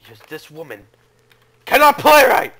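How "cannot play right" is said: sudden high, loud outburst; exasperated yell. Real, not acted. Gamer blames this woman for dying, exasperated yell. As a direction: burst out loudly in frustration. Rages at the game character.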